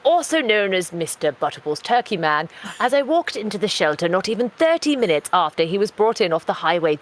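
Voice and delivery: posh British nature-documentary narrator; solemn, measured posh British narration; deadpan wildlife narration in posh British; David Attenborough. Acted. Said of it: "do this one in David Attenborough".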